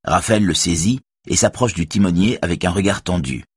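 ordinary delivery, nothing marked

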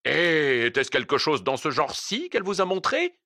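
spring the trap with a ghostly cry; the ghost revealing itself through the vendor; sinister cry. A narrator's reading. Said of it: "s'écria le marchand".

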